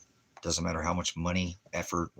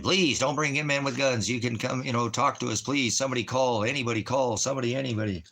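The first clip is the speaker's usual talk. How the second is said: urgent pleading, raised and louder; begging; 6 semitones higher, 5 dB louder than usual. Retold or imitated. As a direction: plead with them in a high, pressing voice. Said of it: "Begging them".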